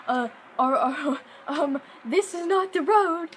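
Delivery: stammer, then insist loudly it's not the road; alarmed protest. Retold or imitated. Narrator to grandmother as they drive off-road, alarmed loud protest.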